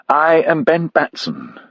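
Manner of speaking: put-on a young officer's stiff British accent, drawn out; introducing himself in a stiff British accent. A narrator's reading. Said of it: "his accent as stiffly British as Grove's".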